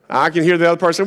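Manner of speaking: ordinary talk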